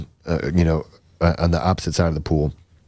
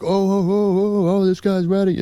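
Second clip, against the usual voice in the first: mock jeering; 13 semitones higher than usual. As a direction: imitate a rowdy group goading someone. Imitates drunk guys jeering back.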